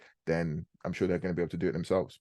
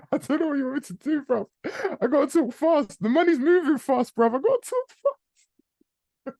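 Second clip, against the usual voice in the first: frantic, very high squeal; mock exasperation; 5 dB louder than usual. Host protesting to viewer that money moves fast, squeaky mock exasperation. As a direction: plead in a comic falsetto, squeaking over the top.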